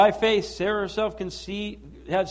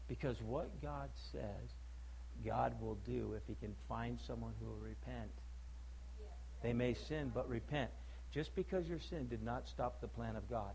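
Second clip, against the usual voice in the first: quiet earnest warning from the pulpit; hushed, low preaching; 8 semitones lower, 7 dB quieter than usual. Acted. Pastor warning the church quietly about sin's cost, hushed and low. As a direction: drop it low and soft, pleading.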